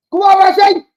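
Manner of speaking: high, loud rising cheer; cheering shout. Real, not acted. Fan yelling Rashi through on goal, high loud cheer. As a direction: yell him through on goal. Urging Rashford on TV.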